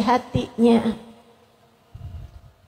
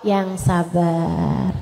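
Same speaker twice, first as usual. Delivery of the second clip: "yang sabar" stretched out very slowly; lingering, patient advice; drawn out; 8 semitones lower, 4 dB quieter, much slower than usual. Acted. Preacher drawing out advice. ("yang sabar" diucapkan sangat pelan dan panjang)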